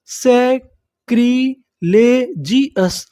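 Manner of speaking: सैक्रिलेजियस drawn out very slowly, falling; slow syllable-by-syllable. Shown as a demonstration. Teacher builds the whole word slowly for students. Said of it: "पूरी meaning क्या बनी हमारी".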